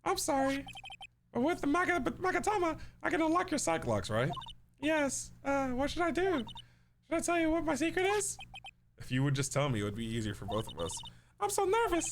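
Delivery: put-on little girl voice. Voices Pearl, nervous.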